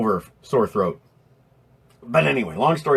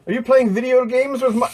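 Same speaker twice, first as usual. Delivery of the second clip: silly call; 12 semitones higher than usual. Pretend. Calling out to a chat viewer.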